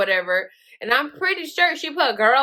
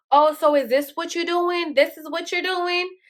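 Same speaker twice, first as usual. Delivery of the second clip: higher-pitched, accusing retold confrontation; mock-indignant challenge, pitched up; accusing wife; 6 semitones higher than usual. Retold wife confronting cheating husband.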